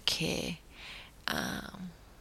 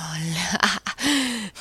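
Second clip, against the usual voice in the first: breathy whispered laugh; 4 dB louder than usual. Real, not acted. Laughing at the word pin.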